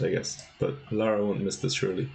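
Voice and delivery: ordinary talk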